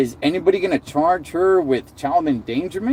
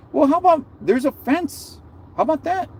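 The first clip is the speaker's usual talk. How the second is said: incredulous; 11 semitones higher than usual. Real, not acted. Ranting about grieving mothers, pitched-up incredulous scoff. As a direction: pitch jumps way up in disbelief.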